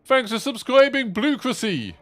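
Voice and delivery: very high, loud thank-you call; high-pitched streamer thanks; subscriber shout-out. Acted. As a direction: announce the new subscriber pitched right up.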